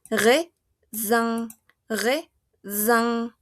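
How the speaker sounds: "raisin" as re... ZAN, buzzing the z; slow, syllable-split "raisin" with z; French "raisin", middle S voiced as z; voiced Z sound. Shown as a demonstration. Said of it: "va a sonar a la Z francesa".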